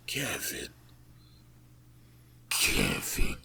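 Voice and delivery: eerie breathy call, falling; demon voice. Acted. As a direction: call his name like a demon luring him. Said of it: "here's the voice calling him again".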